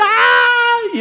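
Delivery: high, loud crying scream of panic; panicked scream. Acted. Disciples in a sinking boat, panicked crying scream. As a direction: shriek in terror as the boat floods. Panic cry during the storm.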